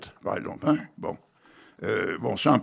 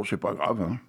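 normal talking voice

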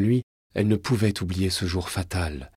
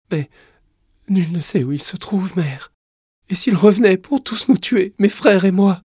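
put-on high child voice, rising louder; anxious child; 11 semitones higher, 8 dB louder than usual. Boy king fearing Montgomery will kill them, anxious. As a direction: voice a worried boy building to panic. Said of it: "un regard chargé d'inquiétude".

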